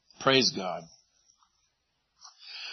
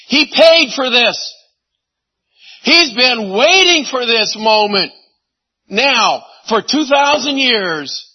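louder, higher, slowed passionate build; fervent preaching; 8 semitones higher, 7 dB louder than usual. Real, not acted. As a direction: preach it with mounting fire.

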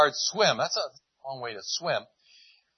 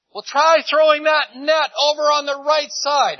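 much higher, louder and slow, a hail across the lake; calling out across water; 14 semitones higher, 12 dB louder than usual. Retold or imitated. Jesus calls to the tired fishermen on the boat, a loud slow hail. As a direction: stretch it out to carry a hundred yards.

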